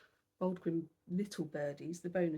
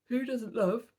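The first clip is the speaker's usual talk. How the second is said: louder, higher, caught by a yawn; tired yawn breaking into the words; 5 semitones higher, 7 dB louder than usual. Real, not acted. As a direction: start the question and let a yawn take over. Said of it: "I'm so tired".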